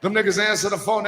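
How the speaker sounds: normal talking voice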